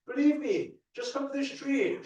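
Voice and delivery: very high, loud, hammed-up begging; mock desperate pleading. Preaching law of attraction to people shutting doors, mock desperate pleading. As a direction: squeal it out like a frantic convert.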